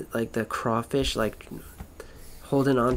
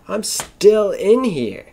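louder, higher and slow, stretched in disbelief; awed outburst; 8 semitones higher, 7 dB louder, slower than usual. Real, not acted. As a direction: marvel out loud that you're still you. Existential rant, sudden emphatic outburst.

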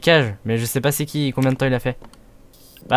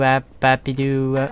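ordinary talk

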